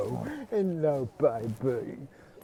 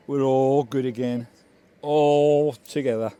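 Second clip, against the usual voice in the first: loud, slow, happy cheering; loud happy owner celebrating his dog; joyful announcement; 3 semitones higher, 7 dB louder than usual. Real, not acted. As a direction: proclaim it with delight. Celebrating dog's return.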